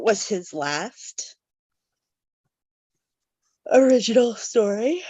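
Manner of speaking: talking through a yawn; slow words stretched by a yawn. Real, not acted. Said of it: "as I yawn like crazy".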